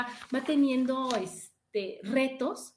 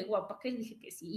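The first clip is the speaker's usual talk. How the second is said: softly retold panic; 3 semitones lower, 8 dB quieter than usual. Her own fear in helicopter.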